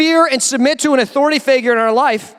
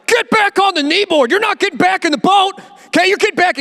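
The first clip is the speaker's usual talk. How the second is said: bossy husband; 7 semitones higher than usual. Acted. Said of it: "your husband was like".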